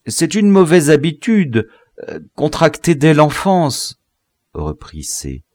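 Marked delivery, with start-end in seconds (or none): 0.1-4.0 high-pitched, hesitant excuse; flustered apology; apologetic. A narrator's reading. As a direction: excuse yourself nervously. Prisoner apologises in fear.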